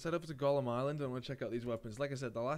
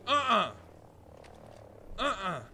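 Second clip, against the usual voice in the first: loud protest; 8 semitones higher, 5 dB louder than usual. Real, not acted. Reacting to near miss.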